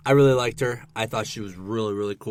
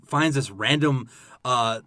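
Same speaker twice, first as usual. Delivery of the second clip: ordinary delivery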